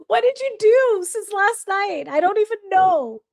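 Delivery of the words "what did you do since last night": usual speaking voice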